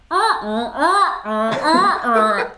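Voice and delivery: loud rising honks like a goose. Shown as a demonstration. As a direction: honk it out like an angry goose. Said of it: "can you do a goose noise".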